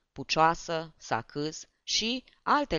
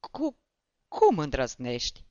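put-on a stunned drunk stammers back, voice lowered and changed; stammering indignation; 3 dB louder than usual. A narrator's reading. A beaten comic stammers at the barber, stunned and outraged.

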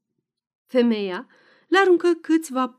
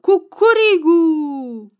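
high, loud, slow drawn-out cock crow; commanding rooster crow; 6 semitones higher, 10 dB louder, much slower than usual. A narrator's reading. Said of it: "cocoșul răspunse mai aspru și mai poruncitor".

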